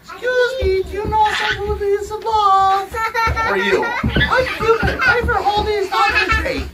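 very high, gleeful Cupid voice; high-voiced Cupid. Cupid introducing self to a passerby, high and excited.

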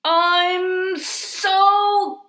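put-on high, halting, exasperated child; slow, strained build into grrr; frustrated growl. A narrator's reading. Child stuck, bursting with frustration.

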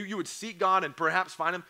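normal talking voice

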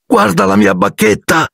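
high, stressing "mia", dismayed; rising upset over "mia"; distraught cry. A narrator's reading. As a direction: show him the broken wand in despair. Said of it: "con voce tremante".

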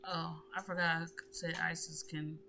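ordinary talk, nothing marked

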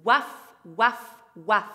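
barking like a dog; loud woof warm-up; dog barks. Shown as a demonstration. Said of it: "Pour bien réchauffer la bouche".

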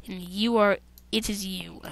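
usual speaking voice

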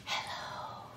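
tiny whisper; whispered hello. Shown as a demonstration. Librarian shows the quietest hello to kids. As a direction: breathe it out in a whisper. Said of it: "as quiet as you can".

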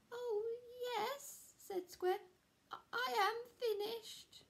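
very high, sheepish admission; confess, stammering up high; sheepish Square. Acted. Square admits he's finished.

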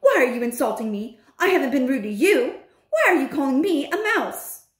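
indignant protest. Acted. Bruno to the one calling him a mouse, indignant. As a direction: protest an insult, pitch climbing.

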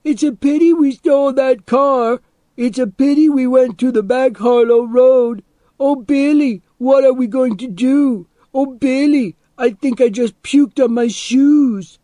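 mocking imitation, very high and loud; high-pitched, jeering copy of someone panicking; Charlie Hogan. A narrator's reading. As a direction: mimic a frightened whiner at a yell. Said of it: "he copied Charlie Hogan's voice".

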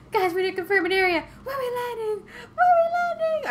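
building panic about the landing spot; rising alarm. Real, not acted. Urgently asking where to land.

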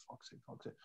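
whispered fuck's sake under the breath; exasperated whisper to self; muttered under breath. Retold or imitated. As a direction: mutter it to yourself, barely audible. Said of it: "I was like".

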